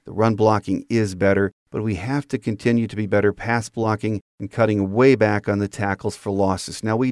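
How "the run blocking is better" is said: ordinary talk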